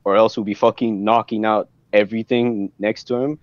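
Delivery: ordinary delivery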